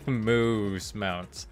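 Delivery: ordinary talk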